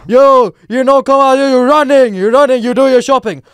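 put-on high, loud accented taunting; mock belligerent yelling; imitated jeering shout. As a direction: goad someone loudly as they walk off.

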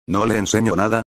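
ordinary delivery, nothing marked

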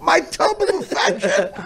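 high, slow mock shout; mocking holler. Co-host ridicules guest's phrase, high mocking holler. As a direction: holler it out, hammed-up and drawn out.

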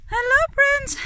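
loud, high call to a pet; high-pitched happy hello; excited greeting. Real, not acted. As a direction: greet the dog with delight. Calling to the dog.

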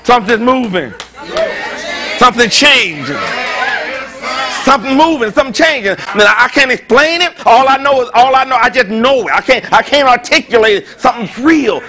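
loud, high, impassioned preacher cry; fervent preaching. Acted. Pastor to the congregation, fervent shouting preaching. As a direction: pour it out like a preacher on fire. Preaching at full force opening.

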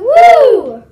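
loud, high whoop; excited cheer. Real, not acted. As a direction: cheer at the end of the song. Cheer after birthday song.

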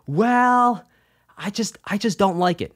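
high, drawn-out hesitant "well"; hesitant reply. Retold or imitated. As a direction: stall on a high note, then admit it. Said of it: "And I'm like".

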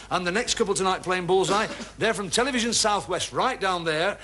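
announcing the first couple to the studio crowd; game show announcer. Acted. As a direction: bring them on like a showman. Host announces third couple.

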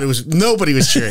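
normal talking voice

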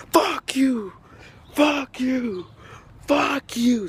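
high, repeated mock yell; imitated yelling. Vlogger replaying the lady's rant to viewers, high mock yelling.

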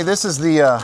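ordinary talk, nothing marked

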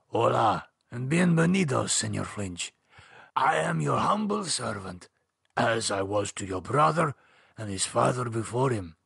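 lightly voiced old Spanish lawyer; a hint of a courtly old man. A narrator's reading. Old Spanish lawyer greets David.

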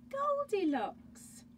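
gasp. Acted. Dramatic reveal of Goldilocks.

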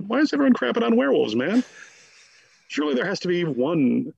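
exasperated, leaping up in pitch to defend the werewolves; mock outrage.

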